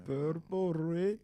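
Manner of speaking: put-on Indian accent. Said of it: "say Purple Rain in an Indian accent".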